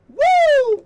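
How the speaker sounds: high, loud whoop of delight; excited whoop. Real, not acted. Thrilled owner shouting at the old console, loud whoop. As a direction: cheer out loud at the find.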